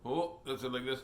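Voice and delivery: ordinary delivery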